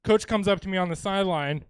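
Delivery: usual speaking voice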